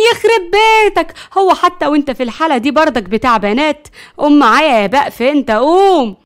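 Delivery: high, loud scolding; angry outburst. A narrator's reading. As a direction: yell at the grandson to get up. Grandmother scolds drugged grandson. (زعيق بغضب)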